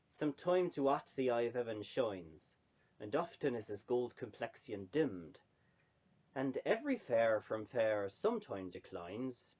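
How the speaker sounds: a light early modern English accent, slowing toward the last couplet; Shakespearean original pronunciation. Shown as a demonstration. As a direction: recite the sonnet as Shakespeare's London spoke it, unhurried at the end. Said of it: "the actual pronunciation of Shakespearean English".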